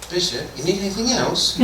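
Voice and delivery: high-pitched, hammed-up English accent; mock English accent, as listeners heard it; exaggerated English accent. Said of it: "this is how they heard it".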